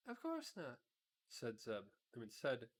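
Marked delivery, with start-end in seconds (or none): 0.1-0.8 Dorothy's voice. A narrator's reading. Dorothy answering in the story.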